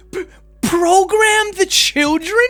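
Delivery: high, drawn-out squeal of disbelief; mock incredulous. Real, not acted. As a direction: stammer it out high and slow, aghast. Mocking disbelief at the other's phrase.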